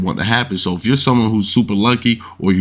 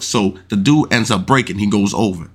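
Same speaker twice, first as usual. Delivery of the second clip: usual speaking voice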